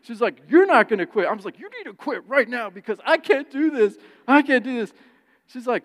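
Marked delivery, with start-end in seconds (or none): 0.0-1.3 loud, higher refusals; the wife shoots back at you; loud mock defiance; defiant shouting. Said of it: "she gets in my face". 1.6-4.9 high and loud, begging; higher, louder desperate whine; desperate plea. Retold or imitated. Desperate plea mid argument.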